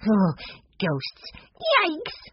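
high squeaky yikes; mock scared. A narrator's reading. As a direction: shiver out a childish fright. Benny shivers at ghosts.

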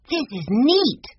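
put-on high, excited little-boy exclamation; excited cry. A narrator's reading. Benny admiring the pictures he is shown, excited cry. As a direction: pipe up high with delight. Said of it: "cried Benny".

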